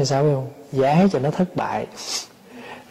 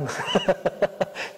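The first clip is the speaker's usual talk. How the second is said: soft, breathy chuckle; 5 semitones higher, 8 dB quieter than usual. Real, not acted. Preacher amused by own voodoo-doll example, chuckling.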